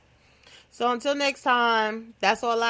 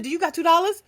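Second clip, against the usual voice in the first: loud, higher cheeky demand; cheeky ask; 8 semitones higher, 5 dB louder, faster than usual. Retold or imitated. Tina mocks the caller by asking for money, loud and cheeky. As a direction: bounce it back, bold and loud.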